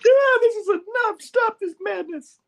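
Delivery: very high falsetto outburst; hammed-up squeaky comic-book heroine; Betty. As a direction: squeal it in mock exasperation. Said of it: "doing those horrible voices".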